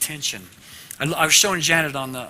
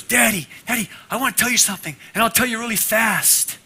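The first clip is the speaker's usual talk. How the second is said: put-on high, louder excited child voice; bubbling daughter rushing to tell Dad; eager little girl; 8 semitones higher, 5 dB louder than usual. Little daughter eager to tell her father news, high and excited. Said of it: "his youngest daughter, Colleen".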